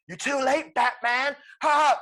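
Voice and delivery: high, loud villainous taunt; mock villain gloating. Poison Ivy taunts Batman as he drops in, gloating. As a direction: crow it with a staged laugh.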